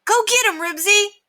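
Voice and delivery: high, loud cheer. A narrator's reading. Scooter cheers Ribsy in the fight, excitedly. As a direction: egg the dog on loudly.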